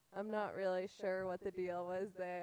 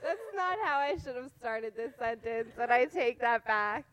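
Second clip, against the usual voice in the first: laughing; 3 semitones higher, 14 dB louder than usual. Real, not acted. Laughing at her own slip.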